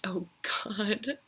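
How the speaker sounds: hushed, breathless whisper; terrified whisper. A narrator's reading. Killer hearing the heart grow, terrified whisper. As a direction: whisper it in dread.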